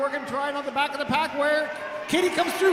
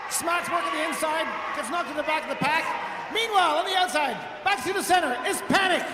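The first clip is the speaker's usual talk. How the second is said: sports commentator; 5 semitones higher than usual. Real, not acted. Live call of jammer breaking through.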